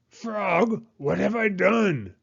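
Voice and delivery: high, anxious cry of regret. Acted. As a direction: cry out realising your mistake. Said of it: "cried Toad".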